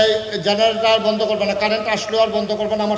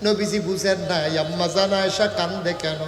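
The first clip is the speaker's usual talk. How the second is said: usual speaking voice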